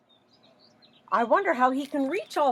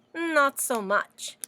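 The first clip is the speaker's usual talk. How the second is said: ordinary delivery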